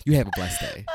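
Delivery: loud, laughing fake blessing; mock-kind dismissal; sweetly barbed sign-off. Shown as a demonstration. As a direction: say it loudly as a cheerful brush-off. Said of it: "is like saying fuck you".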